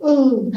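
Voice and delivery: sharp high gasp of air knocked out; high breathless ooh; winded ooh. Retold or imitated. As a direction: imitate a guy losing his wind. Friend punched in solar plexus.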